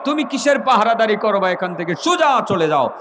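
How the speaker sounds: high, forceful scolding; stern roaring rebuke. Acted. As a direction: roar the name and order him off. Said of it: "কঠিন হুংকার দিয়ে ধমক দিয়ে".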